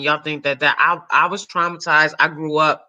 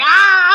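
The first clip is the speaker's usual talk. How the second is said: high startled shriek; mock shriek; 8 dB louder than usual. Speaker reacting to Monique's roast, high shriek. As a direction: yelp it out, hammed-up. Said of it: "I was like".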